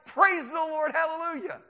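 high, loud hallelujah cry; jubilant praise. Acted. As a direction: rejoice out loud. Acted rejoicing at being scoffed.

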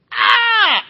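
huge, high re-enacted scream; full-volume mock yell of pain. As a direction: shriek as if suddenly stung. Re-enacts his own jellyfish sting.

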